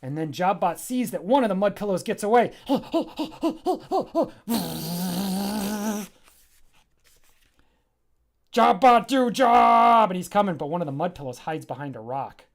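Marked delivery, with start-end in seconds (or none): 2.6-6.1 gasp. Acted. Mud pillow escaping. 8.5-10.1 loud high order; shouted command. Acted. Jammer orders Jobbot to attack, loud shout.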